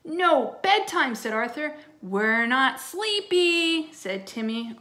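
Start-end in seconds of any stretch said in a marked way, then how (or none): 0.0-1.2 loud, high command; firm yell. Acted. Babysitter ordering rowdy twins to bed, firm yell. 2.0-3.9 put-on loud, high, drawn-out child's protest; defiant yell. Twin refusing bedtime from the babysitter, defiant yell.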